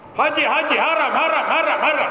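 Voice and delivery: sing-song call of a bus driver touting passengers. Retold or imitated. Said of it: "supir bus itu cakap".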